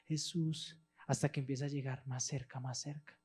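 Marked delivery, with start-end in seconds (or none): 0.0-0.8 distant crowd calls. Acted. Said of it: "gritan más lejos".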